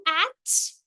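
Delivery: "at" set apart as the end of cat; isolated rhyme. Shown as a demonstration. Teacher isolating the rhyme of cat, "at" set apart. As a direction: say "at" alone, slowly, as a separate word piece. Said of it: "é o rhyme".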